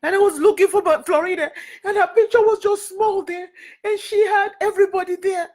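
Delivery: high, tearful voice; real crying. Real, not acted. Speaker describing Florida's small picture on the banner, crying. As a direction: push the words out through sobs. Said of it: "why I'm crying over that banner".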